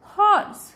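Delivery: हॉर्स high, starting on ह. Shown as a demonstration. Teacher modelling H for learners, high हॉर्स with ह. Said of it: "ह के रूप में करते हैं".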